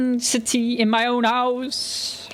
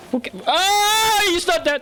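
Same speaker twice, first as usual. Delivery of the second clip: shocked; 10 semitones higher than usual. Real, not acted. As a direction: gasp in disbelief as he moves. Sees supposedly dead man move.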